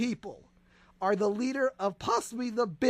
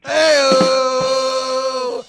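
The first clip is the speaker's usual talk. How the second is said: Hey-oh yell; 3 semitones higher, 12 dB louder than usual. Acted. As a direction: belt out the hey-oh. Shouted catchphrase cry.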